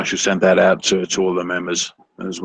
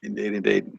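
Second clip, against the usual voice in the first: quiet; 6 dB quieter than usual. Acted. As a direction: drop indeed, indeed to a hush. Echoed sign-off, loud then soft.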